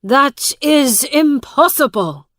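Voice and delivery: loud high cry of disbelief; a brother's stunned shout. Acted. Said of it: "shouted Miles".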